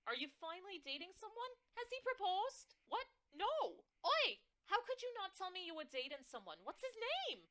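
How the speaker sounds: very high, loud, fast thrilled mom; excited New York mother. Acted. Mother thrilled her daughter might be engaged, shrieking. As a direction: shriek with delight over a boyfriend. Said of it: "My mother's shrill, New York-tinged voice".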